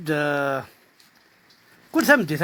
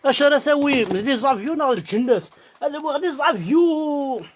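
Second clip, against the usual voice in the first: mock recitation; 5 semitones higher than usual. Drinker riffing on what to do, mock recitation. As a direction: declaim the refrain and stretch the last word long. Same mock refrain, last word drawn out.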